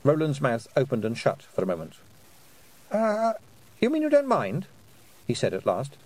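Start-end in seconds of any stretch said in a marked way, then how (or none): 2.9-4.7 high, hesitant question; disbelieving boy. A narrator's reading. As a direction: ask in nervous surprise. Boy hesitantly checking, astonished.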